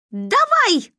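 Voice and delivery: high, loud shout; yelled command. A narrator's reading. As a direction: yell the signal at full tilt. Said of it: "заорала Манька".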